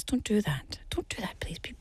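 soft whispered warning, half a plea; hushed plea to stay off the road. Real, not acted. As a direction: whisper it like a worried aside.

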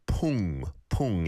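pung with a drawn-out ng; ieung ending. Shown as a demonstration. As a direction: let pung ring on its final ng. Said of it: "it's an iung".

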